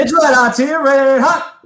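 loud, high, pumped-up cheer; hyped shout. Real, not acted. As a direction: shout it like a hype man. Cohost echoes the hype loudly.

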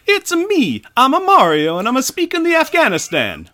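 high, loud Mario-style Italian accent; absurdly hammed-up Italian accent; generic mock-Italian accent. Shown as a demonstration. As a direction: belt it in a cartoon Italian accent. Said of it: "do you wanna hear my generic, uh, accent".

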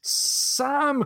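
high, drawn-out exclamation of the name; shocked cry. A narrator's reading. Jack exclaims to Sam on learning his faith, shocked cry. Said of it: "cried Jack".

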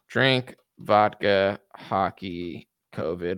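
dragging each word while searching memory; slow musing recall. Real, not acted. Trying to recall the leader's name, slowly listing. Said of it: "What was that guy's name?".

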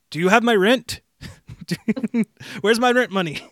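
loud, high nagging landlord demanding rent; gruff impatient scolding. Retold or imitated. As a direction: badger him for the rent money. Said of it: "you have the landlord from Spider-Man two going".

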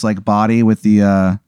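ordinary talk

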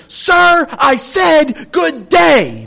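high, slow, shouted dismissal; mock Wonka shout. Preacher as Wonka shouting grandpa out, slow and high. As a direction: bellow it as a hammed-up Wonka.

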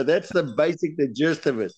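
usual speaking voice

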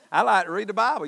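usual speaking voice

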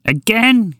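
put-on an elderly woman fed up with the horse again; shrill old woman. Indignant old woman shouts at the horse on her balcony. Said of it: "shake it around threateningly".

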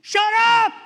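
explosive, high-pitched yell; blunt vernacular shout. Acted. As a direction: roar it as a blunt order. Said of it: "But in the vernacular".

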